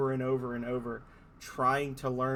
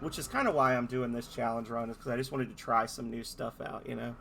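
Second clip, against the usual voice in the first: ordinary talk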